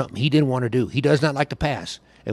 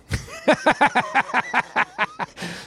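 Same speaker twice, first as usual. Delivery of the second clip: a burst of high laughter at the punchline; 13 semitones higher than usual. Real, not acted. Storyteller laughing at his own story's punchline.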